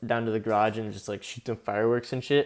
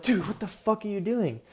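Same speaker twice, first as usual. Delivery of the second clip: alarmed disbelief; 8 semitones higher than usual. Retold or imitated. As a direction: imitate a freaked-out bystander, pitched way up. Retold protest at rocket being shot.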